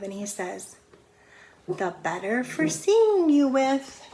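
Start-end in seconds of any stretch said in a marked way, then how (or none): 1.7-4.0 wolf as Granny. Acted.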